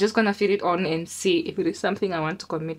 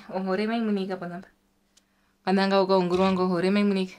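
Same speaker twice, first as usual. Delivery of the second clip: fast Māori phrases; much faster than usual. Real, not acted.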